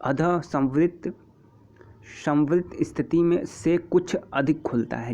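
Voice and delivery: normal talking voice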